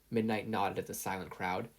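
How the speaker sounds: usual speaking voice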